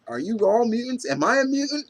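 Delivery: put-on curious girl. Reviewer voicing Araceli quizzing the X-Men, high and curious. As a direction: play a girl firing off questions. Curious girl's rapid questions.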